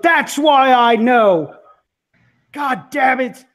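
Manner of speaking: a father blaming them for the escape; angry father. Acted.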